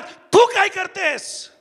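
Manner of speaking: high, drawn-out challenging question; pointed challenge. Real, not acted. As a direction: confront the listener, stretching the words out. Same challenge to women listeners.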